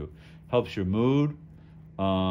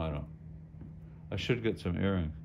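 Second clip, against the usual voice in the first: ordinary delivery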